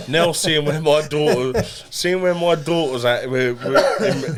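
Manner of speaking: slow and laughing; chuckling through the words. Real, not acted. Laughing about daughter's school.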